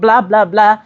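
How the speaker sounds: ordinary talk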